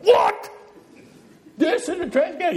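incredulous mock French accent, high and loud. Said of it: "Only with a French accent".

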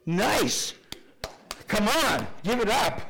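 rally the crowd to applaud; excited cheer. Real, not acted. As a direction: pastor cheering a churchgoer's right answer, loud and excited. Cheering a congregant's correct answer.

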